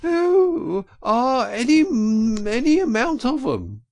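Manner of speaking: very high, loud falsetto sobs; sobbing. A narrator's reading. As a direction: wail it like a frantic washerwoman. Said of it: "sobbed Toad".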